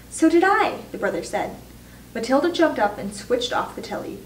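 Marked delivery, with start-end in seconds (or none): 0.1-0.8 high, loud excited burst; excited agreement. A narrator's reading. Brother backing up frightened mother, excited and high.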